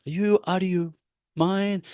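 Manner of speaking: imitating husband snapping in disbelief; incredulous husband. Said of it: "he's like".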